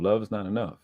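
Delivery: ordinary talk, nothing marked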